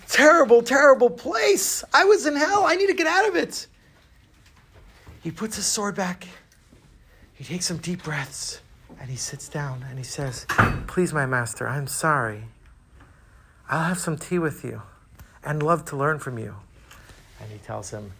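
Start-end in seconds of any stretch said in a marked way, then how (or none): 0.1-3.7 rising, high-pitched panic; alarmed realization. Acted. Samurai realizing he was in hell, alarmed. 10.9-16.6 calm apology. Acted. Samurai sitting down to apologize to his master, calm. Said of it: "when he was calm and happy".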